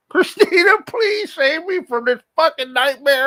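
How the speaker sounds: high, loud fake crying wail; hammed-up sobbing cry for help; mock desperate plea. Begging his partner for rescue.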